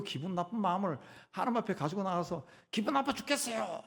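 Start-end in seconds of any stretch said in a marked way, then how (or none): 2.7-3.8 high-pitched cry of complaint; acted yell. Believer brings bad mood before God, acted yelling. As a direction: yell it out in pitch more than volume. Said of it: "라고 소리 지르라고".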